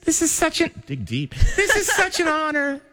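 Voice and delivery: high-pitched pageant queen speech; man doing a woman's voice. Acted. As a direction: gush like a beauty queen accepting a crown. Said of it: "sound like a female actress who just won the Oscar".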